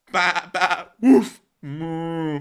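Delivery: goofy farmyard noises for the viewers; animal noises. Pretend. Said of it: "me doing the animal noises".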